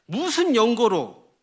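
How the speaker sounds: loud, high, drawn-out challenge; indignant demand. Acted. God demands a reason from Israel, indignant. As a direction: demand a reason, slowly and loudly.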